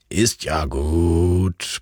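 slow, low, drowsy murmur of a man woken at night; sleepy grumble. A narrator's reading. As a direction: grumble it half asleep to the barking dog. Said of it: "brummte die noch müde Stimme".

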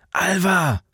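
high-pitched urgent name call; high falling cry, acted with restrained volume. A narrator's reading. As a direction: call out to wake someone in alarm. Waking her as bombers come.

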